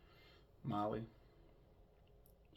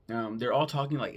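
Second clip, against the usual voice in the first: normal talking voice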